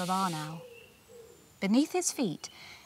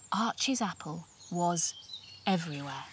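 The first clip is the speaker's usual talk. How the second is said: hushed reveal; 3 semitones lower, 9 dB quieter than usual. A narrator's reading. Dramatic reveal.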